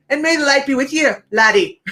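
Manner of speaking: playful attempt at a Scottish accent. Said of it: "he'll do the accent".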